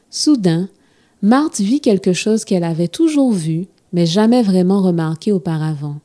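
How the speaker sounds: plain reading voice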